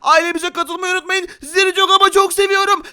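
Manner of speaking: high, loud, energetic sign-off; rally the subscribers at full volume; hyped channel plug; vlogger outro. Real, not acted.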